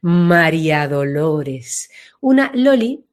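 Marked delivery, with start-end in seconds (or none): 0.0-1.9 slow, drawn-out María Dolores, sounded wide; broad, slow name. Shown as a demonstration. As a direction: let "María Dolores" spread out slowly. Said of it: "se ensancha". ("María Dolores" dicho lento y ancho)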